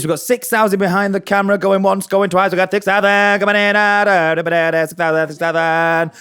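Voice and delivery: fast auction patter, bidding up; auctioneer voice. Pretend. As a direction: call it like an auctioneer. Mock auctioneer calling bids.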